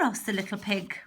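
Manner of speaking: plain reading voice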